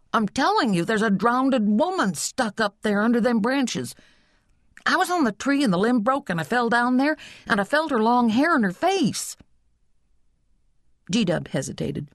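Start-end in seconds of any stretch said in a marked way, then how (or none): 0.1-9.4 put-on high-pitched kid tumbling out his news; urgent, high boyish voice; insistent excited boy. A narrator's reading. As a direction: insist like a boy desperate to be believed. Frightened boy insists on drowned woman.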